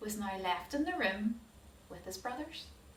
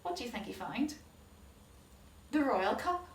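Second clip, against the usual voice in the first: usual speaking voice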